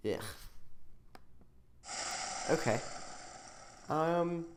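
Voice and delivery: usual speaking voice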